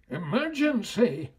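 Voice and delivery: high, loud alarm call; mayor yelling orders to the town; panicked yell. A narrator's reading. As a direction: shout the alarm like a frantic mayor. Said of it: "yelled the mayor".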